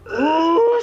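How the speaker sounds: put-on high, loud, drawn-out old woman's ooh; mock old woman's ooh. Man at the club telling a joke, a high, loud old woman's ooh. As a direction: coo like an impressed old gossip. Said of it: "says the other".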